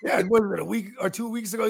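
ordinary talk, nothing marked

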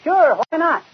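high, brash shrug of a reply; eager. Acted. Husband brushing off wife's doubt, brashly. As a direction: toss it off like it's obvious. Fibber defends scout leader plan.

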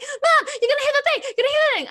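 very high, loud panicky warning; panicked child. Retold or imitated. A scared daughter warning her mum in the car, high and panicked. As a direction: squeal it like a scared kid. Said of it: "She was like".

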